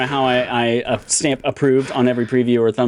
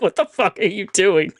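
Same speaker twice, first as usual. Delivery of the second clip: higher, baffled stranger's voice; annoyed stranger; 7 semitones higher than usual. Retold or imitated. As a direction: play the confused stranger next to him. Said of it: "Fucking strangers, like".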